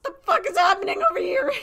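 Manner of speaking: very high, loud wailing yell; mock freak-out. Newcomer bewildered by the art kids, mock shrieking.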